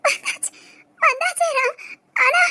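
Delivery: choked sobbing words; crying. Acted. Daughter on the phone to Ram, crying. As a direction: speak through tears. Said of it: "அழுதுகிட்டே இருக்க".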